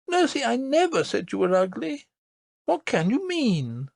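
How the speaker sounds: put-on high, earnest child's voice; a small girl insisting, wide-eyed; little princess voice. A narrator's reading. Princess tells her nurse about the lady, earnest.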